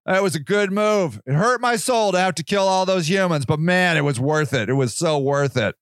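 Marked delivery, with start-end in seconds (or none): none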